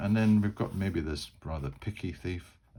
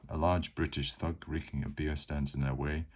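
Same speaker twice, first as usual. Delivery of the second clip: ordinary talk, nothing marked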